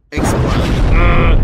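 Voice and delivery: slow, loud stretched explode; mock explosion. Worker joking she'll explode from overfilled sandwiches, slow and loud. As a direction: blow up comically.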